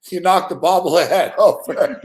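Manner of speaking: real laugh breaking through the words; slow words broken up by laughter. Real, not acted. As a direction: tease him while laughing. Host amused by knocked-over bobblehead.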